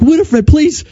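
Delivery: high, strained pleading to his daughter; pleading panic. Retold or imitated. Dad pleading with daughter about the wipes, high-pitched. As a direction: beg her to explain, voice up high.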